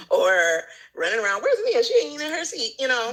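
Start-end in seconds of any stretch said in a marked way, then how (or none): 1.4-2.7 higher, nagging teacher voice; mock teacher calling out. Imitating a teacher scolding a child in class. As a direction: call out like an annoyed teacher.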